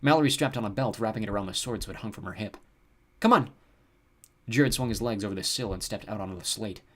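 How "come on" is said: high, loud urging; urgent shout; calling out. A narrator's reading. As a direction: call them to follow. Calling siblings onward.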